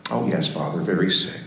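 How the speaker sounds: understated, tired reply; weak and sick. Retold or imitated. Sick student answering his old teacher, faintly weak.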